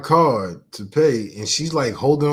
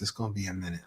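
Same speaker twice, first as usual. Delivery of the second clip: much softer, low-voiced repeat; soft flirty tone; 5 semitones lower, 13 dB quieter, much faster than usual. Cashier at the window, talking soft to him. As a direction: drop it to a soft murmur. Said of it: "And she's talking soft".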